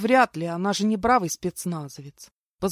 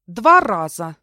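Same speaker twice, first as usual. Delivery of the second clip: loud, gloating jab; a loud payback line at the henchman; vindictive retort; 11 dB louder, slower than usual. A narrator's reading. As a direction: fire it back with spite. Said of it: "мстительно сообщила я".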